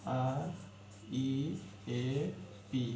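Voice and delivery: each letter stretched out slowly; teacher spelling रिप letter by letter, very slow. Shown as a demonstration. बहुत धीमी वर्तनी. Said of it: "रिप है".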